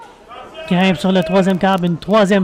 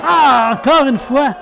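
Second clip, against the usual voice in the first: excited; 4 semitones higher, slower than usual. Real, not acted. Excited call of repeated move.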